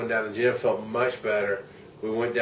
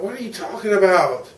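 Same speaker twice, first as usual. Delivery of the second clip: much higher and louder, disbelieving; replaying a baffled reply to his wife; incredulous retort; 9 semitones higher, 5 dB louder than usual. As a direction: fire it back in confusion. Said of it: "I'm like".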